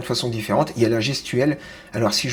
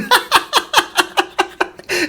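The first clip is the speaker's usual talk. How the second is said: very high, prolonged giggling fit; laughing fit; 4 dB louder than usual. Real, not acted. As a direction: crack up helplessly, high-pitched. Laughing at seeing own blackheads.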